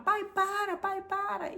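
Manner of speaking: put-on high pleading child voice; imitating crying child. Little son begging his father to stop, high and whiny. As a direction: beg your dad to stop. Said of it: "começou a chorar".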